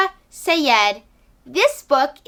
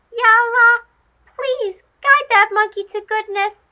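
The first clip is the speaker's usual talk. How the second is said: mouse praying; 10 semitones higher, 6 dB louder than usual. Acted. Mouse prays for the monkey.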